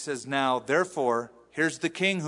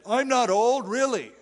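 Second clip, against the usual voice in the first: high, loud protest of a vain denier; mock denial; 9 semitones higher, 6 dB louder than usual. Preacher mimicking people who won't admit age, mock denial. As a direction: protest it in a put-on higher voice.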